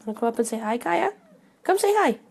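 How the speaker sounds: high sing-song coaxing for the cat. Real, not acted. Host coaxing her cat Kaya to say hi, high baby talk. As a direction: coo up at the cat to lure her over.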